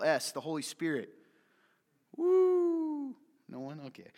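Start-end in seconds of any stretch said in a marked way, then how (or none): 2.2-3.1 high, loud, drawn-out woo; held, ringing "woo"; whoop. Real, not acted. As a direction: let out a long high whoop. Preacher whoops for congregation's response.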